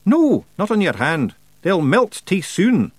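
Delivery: high dialect voice, slowing at the end; Rob Anybody. Acted. Rob warns Tiffany about melting flakes, high dialect voice. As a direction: warn her in a high dialect voice.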